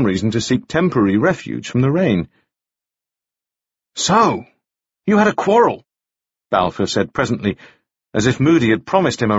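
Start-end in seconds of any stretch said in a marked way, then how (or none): 3.9-5.8 higher-pitched, leisurely character voice; prying listener. A narrator's reading. Balfour prods Moody for the quarrel story, prying and high-pitched. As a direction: draw him out in a raised pitch.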